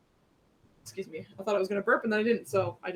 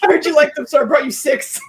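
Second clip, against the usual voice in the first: put-on sketchy boyfriend voice; 7 semitones higher, 11 dB louder than usual. Mock sketchy boyfriend, loud.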